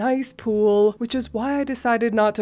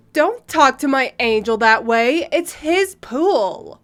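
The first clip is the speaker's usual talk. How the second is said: indignant entitled mother; 4 dB louder than usual. Acted. Mother defends her child in the pool, indignant. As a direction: scold as an outraged, protective mother.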